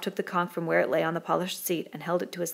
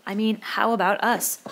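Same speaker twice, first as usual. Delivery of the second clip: higher, louder "how about us?"; insistent repeat; 4 semitones higher, 5 dB louder than usual. A narrator's reading. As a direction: press "how about us" anxiously. Piggy repeats after interruption.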